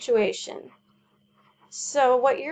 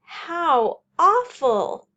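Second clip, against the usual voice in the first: loud, higher, slow cry of dismay; exclaiming; 5 semitones higher, 6 dB louder, slower than usual. Shown as a demonstration. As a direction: gasp it out in horror. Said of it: "For example".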